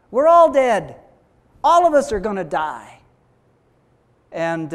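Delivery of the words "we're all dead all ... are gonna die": panicked cry. Acted. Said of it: "the people going".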